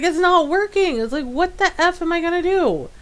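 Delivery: high, whiny mock panic; freaking out. Streamer retelling the screen missing in O B S, mock freaking out. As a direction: act out helpless exasperation up high. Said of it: "And I'm freaking out".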